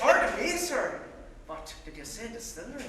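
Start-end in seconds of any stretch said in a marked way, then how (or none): 0.0-1.0 higher, much louder startled outburst; surprised. Acted. Navvy startled to hear of a distillery, loud surprise. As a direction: jump at the word distillery.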